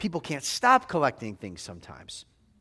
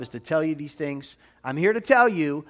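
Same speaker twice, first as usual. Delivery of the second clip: ordinary talk, nothing marked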